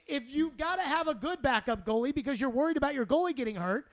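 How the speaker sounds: normal talking voice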